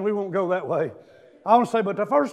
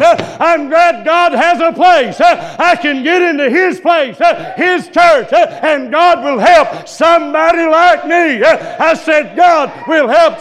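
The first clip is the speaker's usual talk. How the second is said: loud, higher, slow shouted preaching; full-force preaching; 9 semitones higher, 14 dB louder, slower than usual. Acted. Preacher lifting the church, loud fervent preaching. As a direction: preach it to the back pew.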